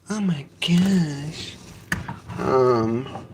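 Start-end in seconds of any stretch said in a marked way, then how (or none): 0.1-1.6 slow, strained from effort; carrying heavy load. Real, not acted. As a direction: puff it out after heavy lifting. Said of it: "that was really heavy".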